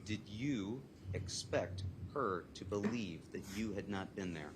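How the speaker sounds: slow, word by word; deliberate repeated question. Real, not acted. Prosecutor repeating the question for the judge, slow and deliberate. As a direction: spell the question out slowly and deliberately.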